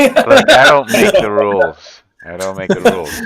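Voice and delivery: loud, high-pitched comic protest; mock protest. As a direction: plead innocence at high volume, easing slightly on the repeat. Jokey protest when teased over accents.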